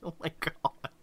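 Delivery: breathy laughing whisper; whispered oh my god. Real, not acted. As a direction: mutter it to yourself in disbelief. Exasperated aside, laughing.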